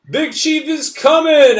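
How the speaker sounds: high, loud, slow announcing yell. Acted. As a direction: herald the chief to the whole tribe. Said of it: "I yell loud".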